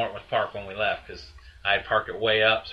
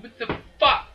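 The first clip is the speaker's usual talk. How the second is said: can't believe what he's hearing; sharp incredulous outburst; outraged disbelief; 13 semitones higher, 4 dB louder than usual. Retold or imitated. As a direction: pitch leaps up on a stunned what the fuck. Shelter refuses to return dogs.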